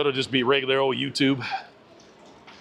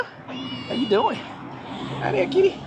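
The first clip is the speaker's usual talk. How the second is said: talking to the cat; 13 semitones higher, much slower than usual. Real, not acted.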